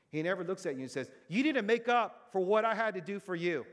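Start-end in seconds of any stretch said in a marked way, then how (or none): 1.3-3.7 loud, raised-pitch demand for repayment; hammed-up stern rebuke; mock scolding. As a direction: act out an accusing parent, high and forceful. Acted scolding God never gives.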